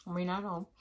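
chewing food between words; speaking with some food in mouth; talking while chewing. Real, not acted. Seen 0: Eats greens at -9; chewing lip motions during and after words.

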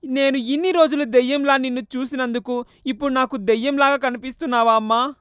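mild acted sobbing; crying. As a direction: son weeping to his mother's ghost. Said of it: "ఏడుస్తున్నాడు".

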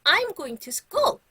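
loud, high, very slow model sentence; loud model phrase. Shown as a demonstration. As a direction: call it out for the class to echo. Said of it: "선생님이 큰 소리로 먼저 얘기해 볼".

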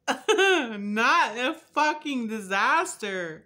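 slow, loud, high lament with laughter; laughing dismay. Real, not acted. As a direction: wail it slowly through a laugh.

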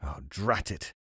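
low, soft cursing aside; muttering to himself. A narrator's reading. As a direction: mutter it as the annoyed carpenter. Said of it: "said to himself".

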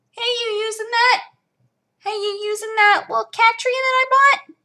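high cooing voice to a pet; pet talk. Real, not acted. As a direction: coo at the cat up high. Talking to the cat.

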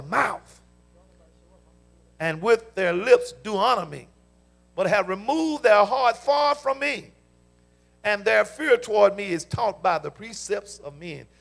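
slow booming proclamation; thundering scripture reading. Real, not acted. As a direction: proclaim the verse with full force.

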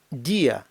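«дія» in two syllables, stress on і; split diphthong. Shown as a demonstration. Teacher models Spanish word for day, vowels split. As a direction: stress the і in «дія», then separate я. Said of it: "ставиться наголос".

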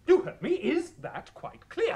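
furious demand. Acted. Choirmaster bullying silent boy.

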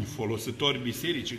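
ordinary talk

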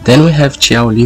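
ordinary delivery

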